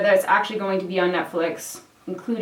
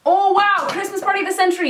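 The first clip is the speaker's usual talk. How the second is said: much higher, louder, fast announcement; excited outburst; 10 semitones higher, 3 dB louder than usual. Real, not acted. Vlogger suddenly remembers party to tell viewers, excited. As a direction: burst out with sudden news.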